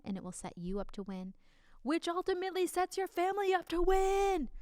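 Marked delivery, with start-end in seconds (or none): 1.8-4.5 triumphant lift. Acted. Big closing flourish.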